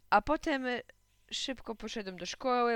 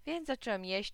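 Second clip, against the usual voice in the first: ordinary delivery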